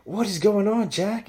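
high, loud happy call; excited greeting. Retold or imitated. Drummer hugging his old friend, a high excited greeting. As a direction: hail your old buddy. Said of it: "He fucking just sees me".

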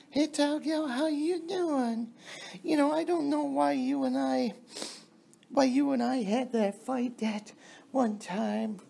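high mocking falsetto of a sweet-talking woman. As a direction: lay it on thick in a girly falsetto. Mocking a woman befriending him.

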